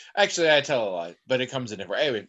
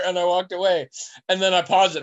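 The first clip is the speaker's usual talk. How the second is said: normal talking voice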